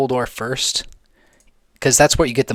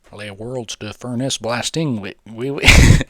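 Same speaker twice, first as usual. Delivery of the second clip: hammed-up fake French, louder and slow at the end; put-on French accent, louder; mock French accent; 3 semitones higher, 5 dB louder than usual. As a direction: ham up a cartoon Frenchman with baguette and cheese. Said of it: "Pardon my French".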